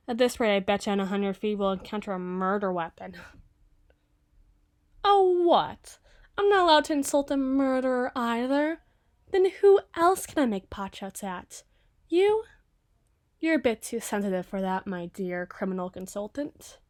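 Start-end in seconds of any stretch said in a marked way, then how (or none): 5.0-12.4 high, loud, sarcastic protest; hammed-up offended squawk, higher and louder; mock indignation. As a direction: throw up your hands in fake outrage. Mock outrage at being scolded.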